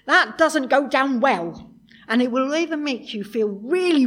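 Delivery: emphatic loud preaching. Acted. Preacher stressing anger point.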